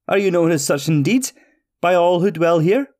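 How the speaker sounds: high, incredulous question; astonished little man. Acted. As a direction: keep the startled pitch up through the question. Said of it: "exclaimed Petrie".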